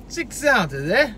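put-on a light New York accent on "Six ounces"; mock New York accent. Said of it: "Get some goddamn New York accent".

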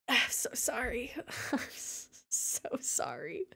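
soft, laughing half-whisper; laughing apology. Real, not acted. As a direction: wheeze out an apology. Apologising while laughing breathlessly.

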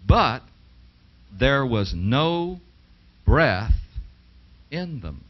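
slow, weighty build to "breath" with pauses before it; drawn-out emphatic "but there was no breath"; stressed slowly. Shown as a demonstration. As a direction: lean on "but" and land hard on "breath". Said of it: "now notice carefully".

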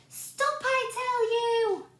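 high, loud alarmed cry. A narrator's reading. Boy crying out as porridge fills the room.

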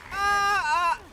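very high double scream; startled scream. Real, not acted. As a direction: scream twice in fright. Screams at demon jump scare.

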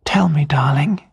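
tender whisper, barely voiced; quiet whisper. A narrator's reading. Wife comforting her crying husband, whispering. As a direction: breathe it softly to comfort him. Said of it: "she said quietly".

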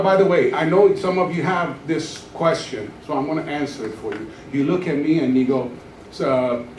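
usual speaking voice

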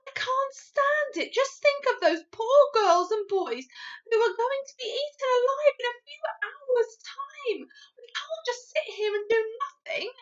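high, loud, urgent pleading; impassioned cry. A narrator's reading. Sophie urging action for the children, impassioned outcry. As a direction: cry out in desperate protest. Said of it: "stood up and cried out".

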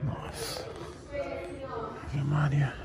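hushed exclamation. Real, not acted. Reacting quietly to loud snoring.

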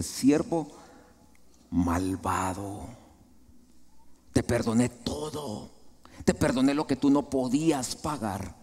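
low, measured rebuke from a king; stern reproach. Acted. King confronts the servant who would not forgive, stern reproach. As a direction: draw out "malvado", then reproach with restraint.

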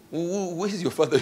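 high, halting, half-laughing stammer; stammering question. Retold or imitated. Father retelling how he stammered at the kid. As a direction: trip over the first word, laughing at yourself. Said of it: "I wanted to say".

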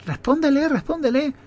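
high-pitched urgent prompting; urging classmates. Retold or imitated. Classmates pressing a pupil to answer the teacher, high urging. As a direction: imitate classmates nudging, voice jumped up high. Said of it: "algunos compañeros me decían".

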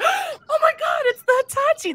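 very high shocked cry; shocked realization. Retold or imitated. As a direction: squeal it as it dawns on you. Sudden realization of fire.

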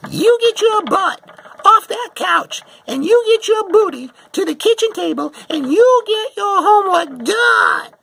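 screeching very high and loud, nearly crying; mother hollering at a kid on the couch; harsh mom yell. Retold or imitated. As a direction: bellow it like a mother at the end of her rope. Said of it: "probably not saying it nicely".

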